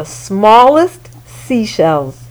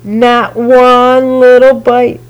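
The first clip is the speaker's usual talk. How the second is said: ordinary delivery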